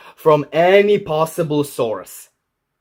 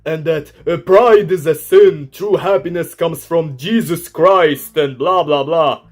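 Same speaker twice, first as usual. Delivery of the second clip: high, loud sneering mimicry; mocking; 5 semitones higher, 7 dB louder than usual. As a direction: parrot the critics with scorn. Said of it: "by telling him".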